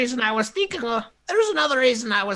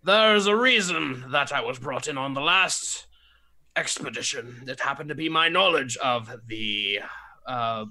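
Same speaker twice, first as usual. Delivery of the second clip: put-on old man voice; 4 dB louder than usual. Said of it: "this is going into Toity voice".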